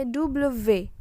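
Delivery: "double V" as "doublə vé"; French letter W. Shown as a demonstration. Teacher showing how W is read, French letter name. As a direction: say "double V" the French way, "doo-bluh-vé".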